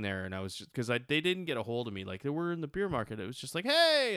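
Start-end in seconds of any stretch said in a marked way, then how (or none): 3.7-4.1 surprised hey. Retold or imitated. Greeting friends he'd been chasing.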